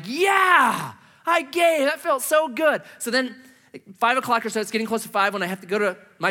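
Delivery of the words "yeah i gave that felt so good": higher, giddy self-congratulation; bubbling over with pride at having given; elated. Retold or imitated. Recalls elation after giving.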